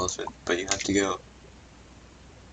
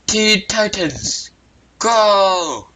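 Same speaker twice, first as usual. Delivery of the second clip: slow, much higher shouted cartoon cry; battle cry; 11 semitones higher, 4 dB louder, much slower than usual. Hero shouting his catchphrase as he wraps the spike, slow high battle cry. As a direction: belt it out like a superhero call.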